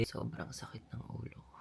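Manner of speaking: barely voiced, head pounding; hungover whisper. Real, not acted. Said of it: "Nag-inom kami kagabi".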